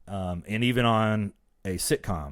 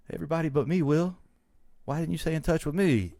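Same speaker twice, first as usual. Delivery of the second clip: higher, reproachful woman's voice; Tyra Banks; 7 semitones higher than usual. Retold or imitated. Tyra arguing with Will, hurt high-pitched reproach. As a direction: demand an answer, pitch raised. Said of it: "And she's like".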